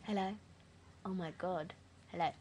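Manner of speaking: normal talking voice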